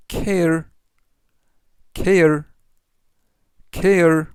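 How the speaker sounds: teacher modelling care for learners to repeat; model pronunciation. Shown as a demonstration. Teacher drilling care for the class, slow and clear. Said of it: "이라고 발음하시면 되겠고요".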